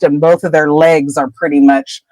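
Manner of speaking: ordinary delivery, nothing marked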